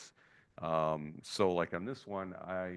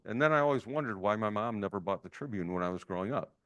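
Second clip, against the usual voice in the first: usual speaking voice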